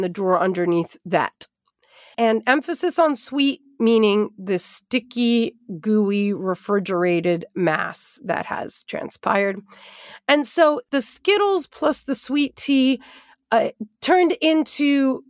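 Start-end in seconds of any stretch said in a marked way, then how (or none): none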